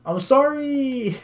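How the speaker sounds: very high, long drawn-out wail; wailed apology. Acted. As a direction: cry it out as if meaning it. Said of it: "Say it like you mean it".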